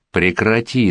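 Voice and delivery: ordinary delivery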